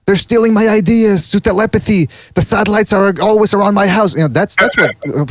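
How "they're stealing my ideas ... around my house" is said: mock homeless rant. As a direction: play a raving street guy, pitched up. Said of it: "homeless people ... go".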